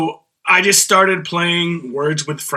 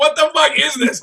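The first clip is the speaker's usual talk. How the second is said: exasperated; 10 semitones higher than usual. Retold or imitated. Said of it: "I'm like".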